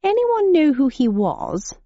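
slow, high plummy English gent; posh gentleman. A narrator's reading. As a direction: ask lightly like an old colonel. Gentleman character voice.

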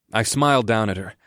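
plain reading voice

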